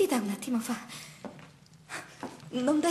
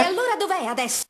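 angry; 5 semitones higher, 6 dB louder, faster than usual. Acted. Mother confronts lying daughter.

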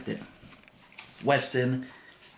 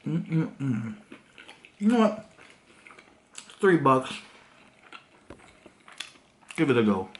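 chewing while talking; talking between bites; mouth full of chicken; 4 semitones higher than usual. Real, not acted. Seen 2: Bites nugget at -2, chews with closed lips until ~8s.

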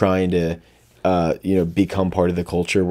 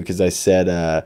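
normal talking voice

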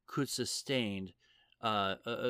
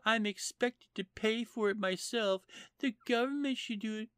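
much higher, whiny mocking voice; mocking complaint; 13 semitones higher, 3 dB louder than usual. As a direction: mimic a spoiled politician whining, pitched way up. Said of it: "she was complaining that".

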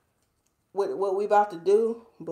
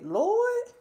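exasperated Lord; 13 semitones higher than usual. Retold or imitated. Exclaiming about the storm.

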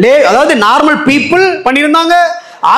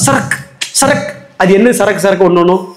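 ordinary talk, nothing marked

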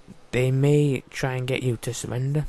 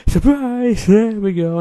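gleeful shout; 6 semitones higher, 8 dB louder, slower than usual. Real, not acted. Pushes guard out window.

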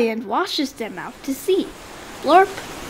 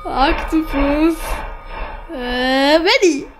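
frightened scream; 2 semitones higher, slower than usual. Acted. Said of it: "he hears a scream".

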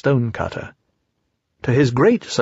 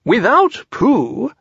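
much higher, slow and solemn; solemn Rabbit; 12 semitones higher, much slower than usual. A narrator's reading. Rabbit tells the friends gravely, much higher. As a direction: announce it gravely. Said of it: "said Rabbit solemnly".

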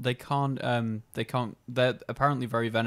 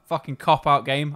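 annoyed; 7 dB louder than usual. Real, not acted. Frustrated at game.

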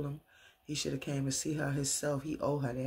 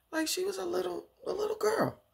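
put-on very high little-girl voice; high, childlike mock voice. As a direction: squeak it like a small child. Imitates Angela as a little girl.

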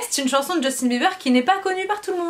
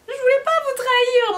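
high dramatic plea; 11 semitones higher than usual. Pretend. Dramatic mock plea to viewers.

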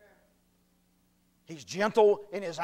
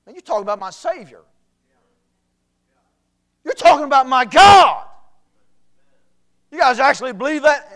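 quick start swelling to a loud, higher outraged cry; indignant retort; 7 semitones higher, 17 dB louder than usual. Retold or imitated. Preacher reliving his answer to the mocking guy, outraged. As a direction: rise from quick protest into a loud outcry. Retold indignant reply to mocker.

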